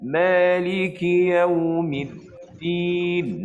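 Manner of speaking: slow chant, voice cut off at the dal of "Yaumiddin"; stretched tajwid chant, dal in "Yaumiddin" clipped; recitation demo. Shown as a demonstration. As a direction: recite "Maliki Yaumiddin" stopping hard on the dal. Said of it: "Nak pergi din tu terputus suara kita".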